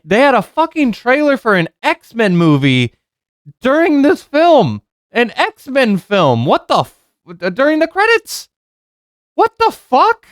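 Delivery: high-pitched incredulous rant; exasperated outburst. Real, not acted. Reviewer appalled by a trailer during the credits, incredulous high-pitched outburst. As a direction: voice climbs into squeaky disbelief.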